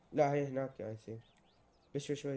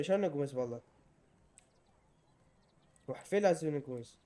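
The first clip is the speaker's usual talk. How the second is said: usual speaking voice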